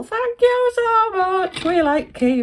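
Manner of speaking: high, slow, cooing thank you; gushing grateful thanks; sing-song thanks. Real, not acted. Thanking the sender on camera, cooing.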